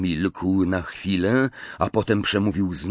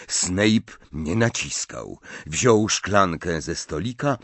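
usual speaking voice

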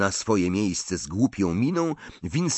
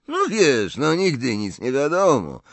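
coy, higher and louder reply; hint of a smile; 7 semitones higher, 5 dB louder than usual. A narrator's reading. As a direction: tease with a secret, faint smile in it. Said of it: "odrzekł Malfoy z cieniem uśmiechu".

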